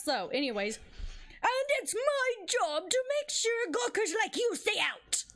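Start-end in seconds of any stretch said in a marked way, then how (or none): 1.4-5.2 put-on high-pitched scolding old woman; Old Bag. Guard shooing gawkers off the studio lot, scolding old woman voice. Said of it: "Anyways, this is Old Bag".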